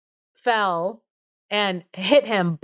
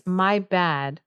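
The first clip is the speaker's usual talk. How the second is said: ordinary talk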